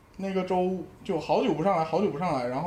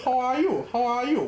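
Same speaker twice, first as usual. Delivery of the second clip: Northeastern English; 5 semitones higher than usual. Shown as a demonstration. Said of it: "我们说".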